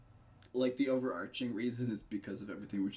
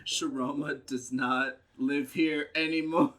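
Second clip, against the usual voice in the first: loud, slow, raised call; pretend announcement; 4 semitones higher, 7 dB louder, slower than usual. Pretend neighbour turning away Sharoma's friends, loud and slow. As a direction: declare it down to the street in jest.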